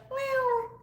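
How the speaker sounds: high cutesy meow; cat meow. Pretend. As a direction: mew like a kitten. Cat imitation.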